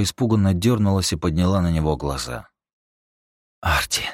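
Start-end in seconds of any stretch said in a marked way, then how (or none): none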